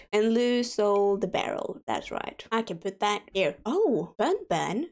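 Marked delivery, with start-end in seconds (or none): 1.9-2.5 lower, hushed whisper; murmured self-approval whispered; whispered aside. Real, not acted. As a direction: whisper it to yourself, much softer. Quiet aside while playing.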